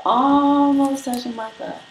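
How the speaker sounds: high, long-held ALMOST; stretched-out ALMOST. Real, not acted. Streamer showing hair reaching her thigh, ALMOST held long and high. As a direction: sustain ALMOST high and slow before finishing the phrase.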